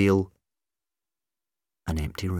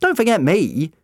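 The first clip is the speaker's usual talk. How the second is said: high, loud, swooping indignation; insist, put out at being overlooked; theatrically peeved; miffed; 11 semitones higher, 8 dB louder than usual. A narrator's reading.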